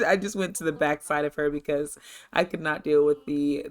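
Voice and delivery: ordinary talk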